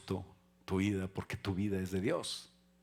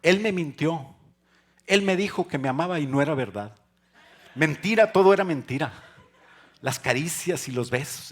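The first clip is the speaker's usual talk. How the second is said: high, loud, hammed-up lament; mock heartbroken wail; 6 semitones higher, 10 dB louder than usual. Preacher mocking a heartbroken song, loud and high. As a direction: ham up a betrayed lover's lament.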